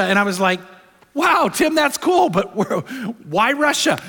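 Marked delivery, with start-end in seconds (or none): 1.1-4.0 high, surprised dad's reply; amazed dad. Retold or imitated. Dad answering his little son, high and amazed. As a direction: gush with surprise at the boy.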